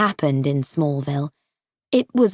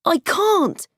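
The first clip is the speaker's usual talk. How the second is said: put-on frightened boy; 10 semitones higher than usual.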